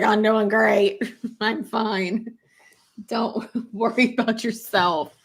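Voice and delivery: breathless, giggling through a fake I'm fine; laughing mock reassurance. Teasing her boyfriend about being out of breath, laughing. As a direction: laugh while insisting you're fine. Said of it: "And I was like".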